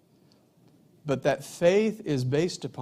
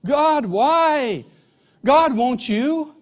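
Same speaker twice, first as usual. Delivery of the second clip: loud, high cry to God; anguished questioning; 9 semitones higher, 7 dB louder, much slower than usual. Acted. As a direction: draw out each question in a pleading shout. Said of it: "begins with these questions".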